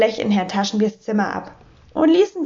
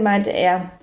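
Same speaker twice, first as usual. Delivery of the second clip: usual speaking voice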